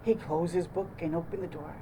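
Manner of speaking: ordinary delivery, nothing marked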